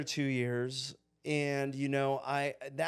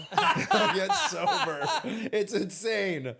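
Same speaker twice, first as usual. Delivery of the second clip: high, slow laugh through the words; 6 semitones higher, 5 dB louder, much slower than usual. Real, not acted. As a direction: laugh it out in disbelief. Laughing at absurd parents' plan.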